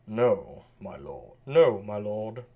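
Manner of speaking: put-on sleek British accent. Edmund answers Kent in a sleek British accent. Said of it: "kind of a sleek British accent".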